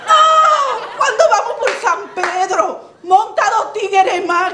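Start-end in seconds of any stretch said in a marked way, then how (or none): 0.1-0.8 a loud, high laughing whoop; laughing shouted oh. Acted. Storyteller whooping at the crowd, high laughing shout. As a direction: let out a big laughing oh. Refrain exclamation to audience.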